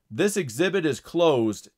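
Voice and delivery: loud, slow announcing yell; yelling guard. A narrator's reading. Guard orders the crowd to disperse, loud yell. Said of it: "yelled the guard".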